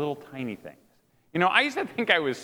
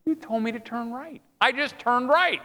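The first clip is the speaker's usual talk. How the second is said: higher, hurt mock protest; protesting dog; 7 semitones higher than usual. Dog answering the master who shook it, protesting. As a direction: plead your case as the dog, drawing out the end. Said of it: "the dog would say".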